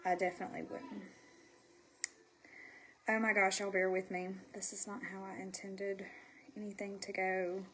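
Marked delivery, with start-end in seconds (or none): none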